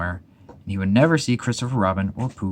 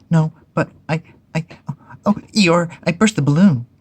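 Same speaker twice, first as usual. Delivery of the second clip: high, louder, stammering Piglet; flustered confession; 9 semitones higher, 6 dB louder than usual. Acted. Piglet confesses to Eeyore that he burst the balloon, high and flustered. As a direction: blurt out the bad news in a panic.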